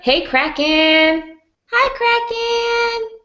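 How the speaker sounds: high, drawn-out hello; very high, stretched-out greeting; singsong greeting. Real, not acted. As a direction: sing out a happy hello to a friend. Greets friend arriving in chat.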